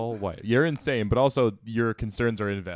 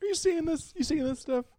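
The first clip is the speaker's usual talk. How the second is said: much higher squeaky mock voice; mock gloating Tom. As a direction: parody the smug husband. Mocking Tom's gloating.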